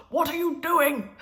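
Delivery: Grandad's sudden loud shout, high and drawn out; imitated angry shout. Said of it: "he shouted".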